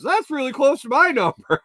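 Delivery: put-on high, puzzled old lady; a dotty older woman noticing something. Old lady voiced in story.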